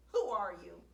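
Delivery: loud angry snarl. Acted. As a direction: snarl it at the stranger. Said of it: "he snarled".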